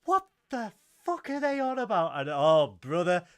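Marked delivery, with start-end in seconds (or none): 0.0-2.1 high, loud put-on bafflement; high hammed-up confused voice; mock confused youngster. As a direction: play a baffled kid. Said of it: "they're just going".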